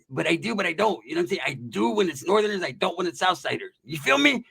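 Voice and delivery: put-on squeaky homeboy. Mustached homeboy justifying himself to a friend, squeaky voice. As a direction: squeak through the explanation from under a mustache. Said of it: "a squeaky voice".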